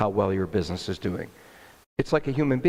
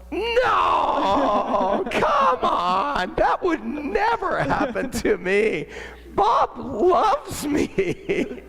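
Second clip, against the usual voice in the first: much higher, laughing shout of denial; laughing disbelief; much slower than usual. Retold or imitated. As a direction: brush off the warning with a laugh. Laughing off the bad news.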